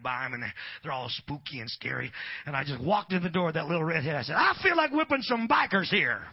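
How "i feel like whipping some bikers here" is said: loud, high playful bravado; mock challenge. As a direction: call out a cocky challenge to the whole room. Retells challenging bikers on entering.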